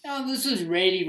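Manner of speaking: ordinary delivery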